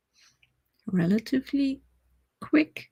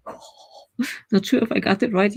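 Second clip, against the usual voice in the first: ordinary talk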